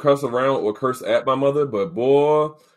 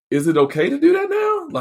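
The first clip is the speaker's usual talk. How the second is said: nervous question; 13 semitones higher than usual. Retold or imitated. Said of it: "I'm like".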